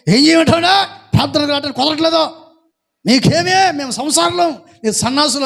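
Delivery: mock irritated villager shouting his excuses; imitating an angry retort. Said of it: "గట్టి గట్టిగా మాట్లాడుతున్నాడు".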